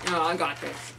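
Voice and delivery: muffled chubby bunny; mouth full. Real, not acted. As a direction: say it with stuffed cheeks.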